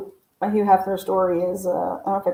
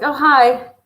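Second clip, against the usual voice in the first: higher, louder startled greeting; surprised hi; 6 semitones higher, 9 dB louder than usual. Retold or imitated. Turning to an old man at a bar, startled. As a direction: caught off guard by a tap on the back.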